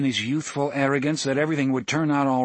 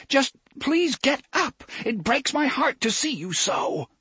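much higher, breaking as if about to cry; near tears. A narrator's reading. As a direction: plead on the edge of tears. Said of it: "sounded as if he were crying himself".

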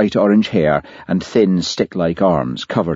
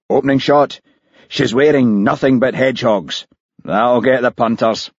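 brash TV producer; 6 semitones higher than usual. Acted. Producer brags about his hedgehog special to visitors. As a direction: sell the show like a showman.

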